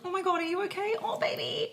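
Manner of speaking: high-pitched, alarmed parent imitation; fussing parent. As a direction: act out a mum rushing over in alarm. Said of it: "the parent would rush over and be like".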